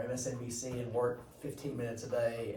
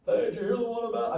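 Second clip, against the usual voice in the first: joke teller; 8 semitones higher, 4 dB louder than usual. Retold or imitated. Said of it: "I don't mean funniest like".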